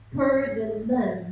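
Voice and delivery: usual speaking voice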